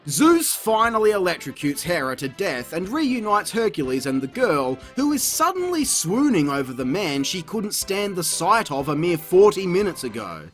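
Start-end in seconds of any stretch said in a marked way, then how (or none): none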